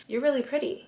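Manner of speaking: understated flirty compliment; normal compliment tone. Shown as a demonstration. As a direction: compliment her without gushing. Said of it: "Not, "You're really pretty".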